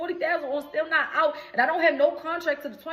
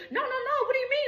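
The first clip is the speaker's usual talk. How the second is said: mock whiny denial; 11 semitones higher, faster than usual. Managers denying, voiced mockingly.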